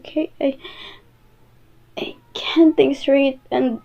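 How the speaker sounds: slow, breathy whisper; hushed voice. Acted. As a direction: whisper it like you're overwhelmed. Overwhelmed partner breaks down.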